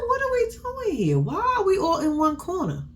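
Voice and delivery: high mock bewilderment at the crowded kitchen; high-pitched confused quote. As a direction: squeak it out in disbelief. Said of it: "I said".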